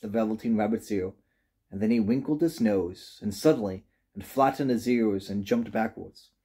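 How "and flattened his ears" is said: ordinary delivery, nothing marked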